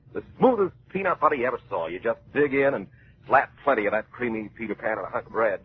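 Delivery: ordinary delivery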